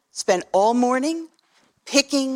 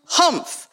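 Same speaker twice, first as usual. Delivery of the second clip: sour humph; 6 semitones higher than usual. Acted. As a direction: snort out a disdainful "Humph". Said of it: "humphed a voice".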